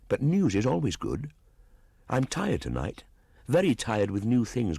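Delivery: put-on a wild boy puzzling aloud; young Mowgli. Mowgli speaks to Grey Brother.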